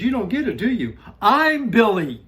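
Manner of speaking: high, loud acted-out dad losing it; exasperated outburst. Exasperated dad's punchline, acted out.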